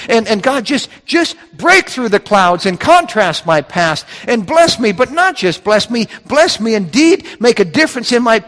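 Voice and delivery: high, earnest plea to God; pleading prayer. Acted. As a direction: plead upward, urgent and insistent, as Jabez praying. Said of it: "That's what he's praying here".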